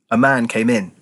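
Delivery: usual speaking voice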